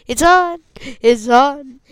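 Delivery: loud and thrilled; excited. Real, not acted. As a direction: call out happily. Excited the mic works.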